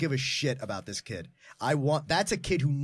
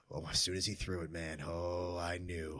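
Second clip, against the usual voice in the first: hushed recollection; 9 semitones lower, 10 dB quieter than usual. Retold or imitated. Cop recalls the taser moment.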